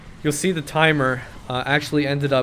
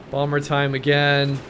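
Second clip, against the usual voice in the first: ordinary delivery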